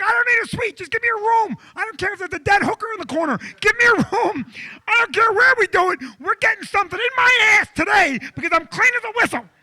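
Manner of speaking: high-pitched, shouted tirade; angry demanding rant. Retold or imitated. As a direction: yell your demands in escalating desperation. Patient rants, demanding a room.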